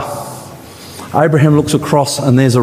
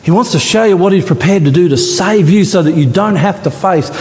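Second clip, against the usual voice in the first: normal talking voice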